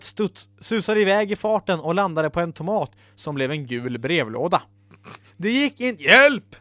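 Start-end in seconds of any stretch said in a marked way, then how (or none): 5.4-6.5 loud, high cry for help; screamed alarm. Acted. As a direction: break off into a yelp of alarm. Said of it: "skrek Ella när hon såg".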